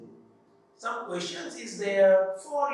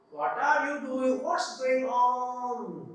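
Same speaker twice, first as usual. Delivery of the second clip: ordinary talk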